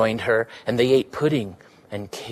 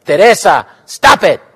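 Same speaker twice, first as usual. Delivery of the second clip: higher, loud order; a loud, firm command; sharp order; 6 semitones higher, 10 dB louder than usual. Acted. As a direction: snap her out of it. Willa restraining the girl.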